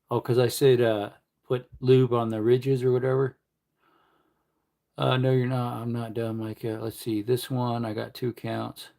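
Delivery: ordinary talk, nothing marked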